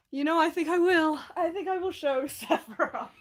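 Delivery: high, loud, giggly agreement; gleeful. Real, not acted. Streamer giggling as she agrees to show the Sephiroth figure, high and loud. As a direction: delight in showing off the figure.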